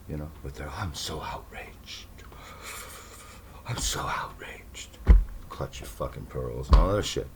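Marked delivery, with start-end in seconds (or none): 0.7-2.1 slow mocking whisper of outrage; mock outraged liberal. Mocking liberals to his audience in a slow whisper. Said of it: "sick caricature of these pussy liberals". 3.7-5.0 slow whispered mock outrage; mock outraged liberal. Mocking liberals to his audience in a slow whisper. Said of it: "sick caricature of these pussy liberals".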